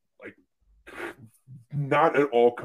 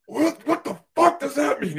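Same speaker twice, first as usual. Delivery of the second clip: much higher, incredulous angry yell in another voice; furious surgeon; 4 dB louder than usual. Retold or imitated. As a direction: demand it like an outraged surgeon. Surgeon yelling at the associate.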